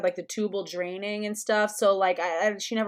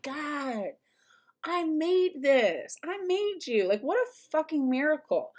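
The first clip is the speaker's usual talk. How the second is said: marveling awe; 7 semitones higher than usual. Retold or imitated. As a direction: breathe it out like a small miracle. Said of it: "I stare at them and I'm like".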